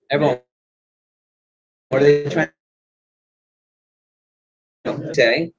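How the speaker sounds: ordinary talk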